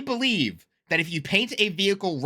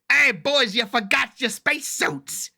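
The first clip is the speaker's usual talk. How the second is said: loud, higher hammed-up New York orc; New York accent; 10 semitones higher, 3 dB louder, slower than usual. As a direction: bark it like a New York tough guy orc. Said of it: "that was a New York accent".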